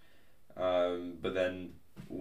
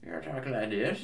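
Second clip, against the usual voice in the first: lightly voiced old grandma; a hint of a little old granny; 4 semitones higher than usual. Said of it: "a grandma voice".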